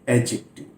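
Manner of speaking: adjective as "a-jective", the D silent; correct pronunciation. Shown as a demonstration. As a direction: say "a-jective" with no D before the J. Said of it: "तो ये डी silent रहता है".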